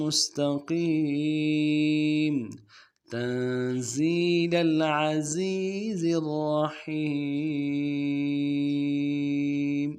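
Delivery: slow melodic tajweed recitation; Quran recitation. Shown as a demonstration. A Quran teacher recites the whole lesson for students in slow recitation. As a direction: chant each verse slowly.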